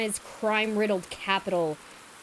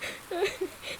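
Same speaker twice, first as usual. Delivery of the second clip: breathy high giggle; 10 semitones higher, 7 dB quieter than usual. Real, not acted. Reaction laugh.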